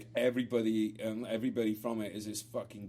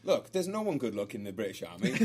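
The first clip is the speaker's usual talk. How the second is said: ordinary delivery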